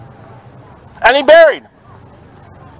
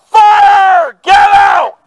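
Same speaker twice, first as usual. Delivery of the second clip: high-pitched shouted alarm; urgent yell; 8 semitones higher, much slower than usual. Acted. Preacher warning people inside a burning house, urgent yell. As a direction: yell it out to clear a burning building. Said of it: "you would yell".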